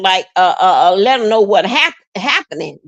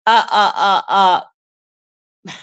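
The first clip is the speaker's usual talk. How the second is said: tearful; 2 semitones lower than usual. Real, not acted. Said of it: "I'm getting so emotional".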